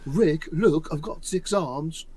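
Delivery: put-on playful English accent, calling for attention. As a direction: do a comic English character voice. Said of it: "Can you all do English accents?".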